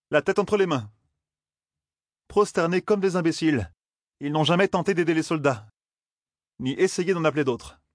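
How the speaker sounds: mocking the kneeling servants. A narrator's reading.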